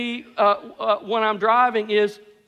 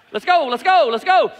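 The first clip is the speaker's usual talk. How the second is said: loud, higher-pitched driver impatience; pushy, raised-voice chant; impatient urging; 5 semitones higher, 4 dB louder than usual. Retold or imitated. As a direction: hurry the car ahead along, loudly. Impatient driver urging car ahead.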